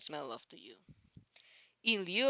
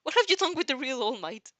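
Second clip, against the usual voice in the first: loud, higher panicked questioning; horrified; 7 semitones higher, 6 dB louder than usual. A narrator's reading. Izuku panicking, accusing the gaunt man of being fake. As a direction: demand it in alarm.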